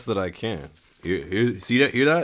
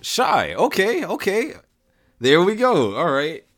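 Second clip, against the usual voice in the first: excited; 10 semitones higher, 5 dB louder than usual. Real, not acted. Reacts to the rolled trait shy.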